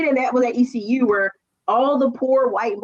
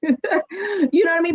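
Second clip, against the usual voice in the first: laughing; 7 semitones higher, slower than usual. Real, not acted.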